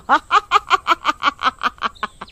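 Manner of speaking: long, high cackle, falling away; evil witch laugh. Acted. Witch after threatening captives, evil cackle. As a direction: let out a villain's staccato cackle.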